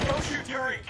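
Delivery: forceful, high-pitched orders under fire; urgent combat shouting. Acted. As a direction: yell warnings to the squad.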